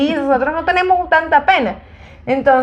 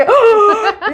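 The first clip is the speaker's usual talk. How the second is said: high laughing shriek; 10 semitones higher, 4 dB louder than usual. Real, not acted. Everyone reacts to her swearing.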